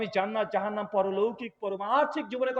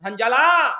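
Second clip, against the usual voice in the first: loud call from outside; 9 dB louder, slower than usual. Retold or imitated. Messenger calling Hanzala from outside, loud call. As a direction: call his name from outside the door. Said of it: "বাইরে থেকে ডাক এসেছে".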